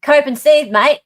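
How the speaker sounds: loud, high Australian demo; punchy Australian accent demonstration. Shown as a demonstration. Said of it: "That's not an Australian accent".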